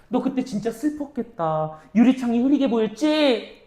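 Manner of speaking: 공감하며 달래듯, "보였지" 끝은 내려서; "보였지"를 묻지 말고 위로하듯 내려 말하기; 위로하는 말투. Shown as a demonstration. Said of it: "위로해 주는 거야". ("보였지" 끝을 내린 공감 어조)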